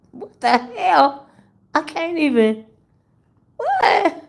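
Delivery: high, slow, stunned what the hell; shocked disbelief. Real, not acted. Reacting to a viewer's joke, high shocked disbelief. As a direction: squeal it in disbelief.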